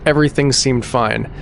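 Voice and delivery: ordinary delivery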